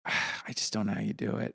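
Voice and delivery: soft, sighing imitation of a mother; weary mom. Mom to son after sold-out club, weary sigh. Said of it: "And she'll be like".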